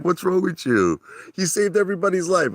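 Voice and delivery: a hint of Maria scolding him; imitating Maria. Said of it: "She's like".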